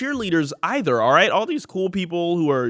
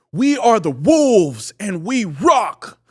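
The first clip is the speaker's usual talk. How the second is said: slow, higher-pitched rhyming chant; rehearsed pep speech; 6 semitones higher, 4 dB louder, slower than usual. Retold or imitated. Captain rehearsing his speech for the pep rally, hammed-up and slow. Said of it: "he's going over his speech".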